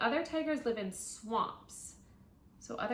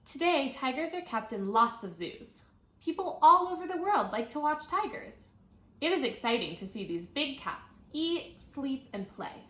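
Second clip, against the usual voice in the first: bright, clear read-aloud voice; reading to children; 4 semitones higher, 6 dB louder than usual. Acted. Teacher reading a tiger book to her class, clear read-aloud.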